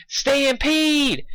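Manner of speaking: high alarmed holler. Acted. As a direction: warn the whole town, pitched high. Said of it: "she hollered".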